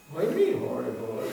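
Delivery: usual speaking voice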